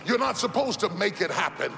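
ordinary delivery

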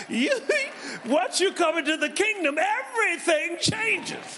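high and loud, laughing through the words; laughing joyfully. Real, not acted. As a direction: proclaim it while still laughing.

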